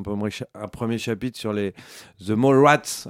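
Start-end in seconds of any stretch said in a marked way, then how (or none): 2.3-3.1 The Mall Rats slow and louder in an English accent; The Mall Rats prononcé à l'anglaise. Shown as a demonstration. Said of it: "avec l'accent".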